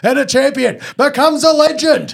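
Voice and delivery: high, loud race caller climax; race calling voice. Retold or imitated. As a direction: bellow the winning horse home. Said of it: "You've got a real, um, race calling voice".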